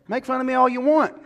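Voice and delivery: loud, high, daring them to mock; preacher daring the congregation to laugh; defiant shout. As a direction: fling it out proudly.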